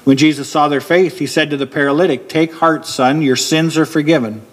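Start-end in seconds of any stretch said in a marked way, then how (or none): none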